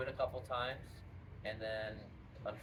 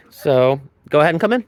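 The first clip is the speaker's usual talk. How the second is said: ordinary talk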